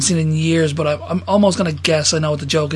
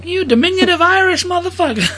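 much higher comic insult; mock insult; 12 semitones higher than usual. Pryor insulting a wrestler, high mock insult. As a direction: sneer it high.

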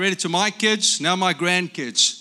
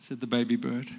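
ordinary delivery

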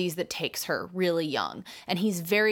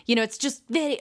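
ordinary talk, nothing marked

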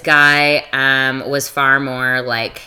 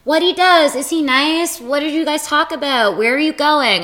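high-pitched chirpy questioning; eager girlfriends; 10 semitones higher than usual. Retold or imitated. Friends grilling her about a new guy, high mock-eager questions. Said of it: "the girls that wanna know".

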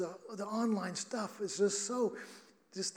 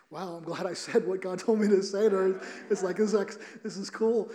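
retold excited relief; 6 dB louder than usual. Retold relief at being proved right.

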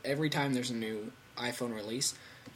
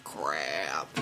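low unvoiced whisper of "Crap"; 12 semitones lower than usual. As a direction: mutter it under your breath. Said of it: "I was like".

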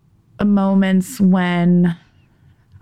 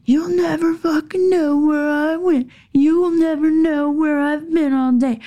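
mock drunk scream; 9 semitones higher than usual. Drunk husband taunting wife, high mock scream. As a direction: imitate a drunk husband jeering. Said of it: "just screaming".

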